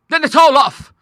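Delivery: high, loud sudden outburst; cry out. A narrator's reading. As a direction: break off the deal with a cry. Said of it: "she cried".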